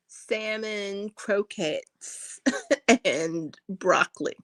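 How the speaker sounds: ordinary talk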